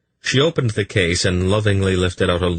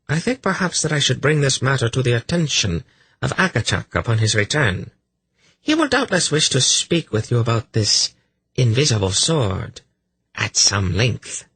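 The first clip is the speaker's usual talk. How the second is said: higher-pitched, veiled threat; menacing priestess; 7 semitones higher than usual. A narrator's reading. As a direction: draw out the last words meaningfully.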